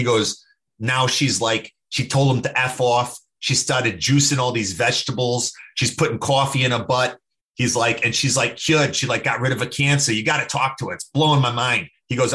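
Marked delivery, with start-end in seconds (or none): 0.8-7.2 terrible imitation Boston accent; Dante. Buddy telling of a sick friend, bad Boston accent. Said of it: "gonna do a terrible Boston accent". 8.0-11.9 terrible imitation Boston accent; Dante. Buddy amazed by a friend's recovery, bad Boston accent. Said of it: "gonna do a terrible Boston accent".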